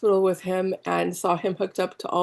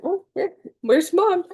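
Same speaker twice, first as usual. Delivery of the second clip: put-on high, cutesy baby voice; voicing the baby; 12 semitones higher, 4 dB louder than usual. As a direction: speak for the baby looking for mom. Voicing the baby's thoughts.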